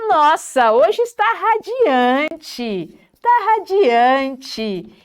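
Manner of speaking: exclamação aguda, palavras bem esticadas; ênfase entusiasmada. Shown as a demonstration. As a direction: saboreie "radiante" com admiração. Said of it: "dar ênfase nas palavras positivas". (entusiasmo efusivo e arrastado)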